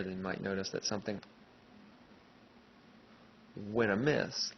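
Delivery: long silent gap after "something", then "went amiss"; gap like cut tape. Shown as a demonstration. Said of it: "kept moving my lips, but it didn't talk".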